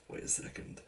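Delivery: breathy whispered double take; suspicious whisper. Retold or imitated. Realising something is off, a suspicious whisper. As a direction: whisper it as the penny drops.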